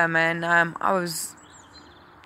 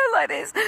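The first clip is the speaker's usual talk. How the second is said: high wailing imitation of a child's sob; hammed-up child's wail; mock crying; 10 semitones higher than usual. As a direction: wail like a small boy bursting into tears. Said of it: "promptly burst into tears".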